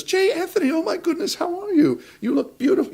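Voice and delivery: high, gushing excited greeting; gushing actress greeting. Retold or imitated. Actress gushing over him in the hallway, high and excited. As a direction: squeal hello like a delighted old friend.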